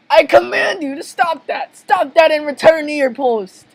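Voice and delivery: high, theatrical order; over-the-top commanding yelp; commanding boss. Acted. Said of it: "why did I say that like that?".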